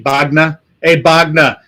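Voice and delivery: hammed-up Boston tough talk, dropping the R in Bogner; Boston accent. Shown as a demonstration. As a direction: call out mockingly in a thick Boston accent. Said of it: "in a Boston accent".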